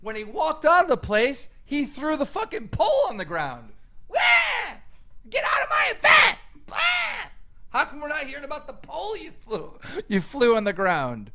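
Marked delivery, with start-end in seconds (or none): 4.1-7.3 high shrieking mock tantrum, loud; mimicking a rival's wailing outburst, pitched way up; mocking whiny yell. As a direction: shriek it like a spoiled brat losing it. Mocking opponent's yell at event.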